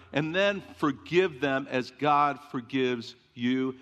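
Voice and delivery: usual speaking voice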